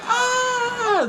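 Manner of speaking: long high-pitched imitated scream; mock scream. Retelling the couple facing the bulldozer, drawn-out mock scream. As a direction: hold a high mock shriek. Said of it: "they're like".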